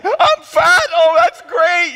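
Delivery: loud, high-pitched imitated wail; exaggerated tearful outburst; mock crying. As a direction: wail it like a hammed-up sobbing wife. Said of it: "She falls down crying".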